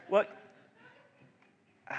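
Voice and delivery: normal talking voice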